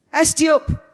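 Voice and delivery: high desperate scream. Acted. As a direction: shriek it to make him stop. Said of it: "I screamed".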